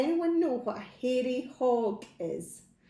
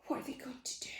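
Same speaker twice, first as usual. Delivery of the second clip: suspenseful whispered question; 12 dB quieter, much faster than usual. Acted. Building suspense for a child, whispered.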